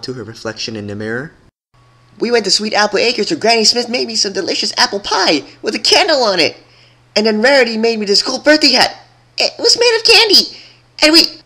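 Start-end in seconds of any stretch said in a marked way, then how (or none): 2.2-11.4 high and loud, climbing ever higher; gushing excitement. A narrator's reading. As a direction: babble on faster and brighter. Pinkie gushing to mirror.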